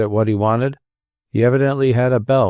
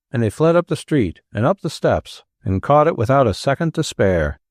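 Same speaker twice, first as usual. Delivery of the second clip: plain reading voice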